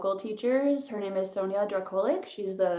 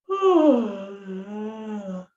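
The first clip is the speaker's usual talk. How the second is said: long open yawning sigh; demonstrated yawn. Shown as a demonstration. Teacher demonstrating a yawn for warmup. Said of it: "just yawn".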